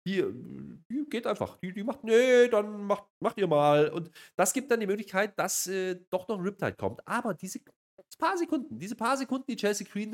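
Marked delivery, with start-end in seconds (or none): none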